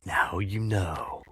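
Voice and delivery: slow, whispery "now you know"; mysterious sign-off. Pretend. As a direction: draw it out slowly in a whisper. Mock-solemn moral after the story.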